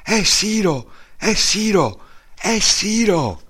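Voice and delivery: high joyful cry of recognition, slowing on the last; a sister recognising her lost brother, high and thrilled; excited exclaiming. Retold or imitated. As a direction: sister shouting his name in disbelief. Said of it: "ed esclamò".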